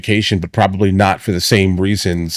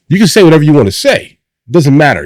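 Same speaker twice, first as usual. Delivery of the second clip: loud sharp voice; 6 semitones higher, 5 dB louder than usual. Real, not acted. Heated parenting rant, addressing kids.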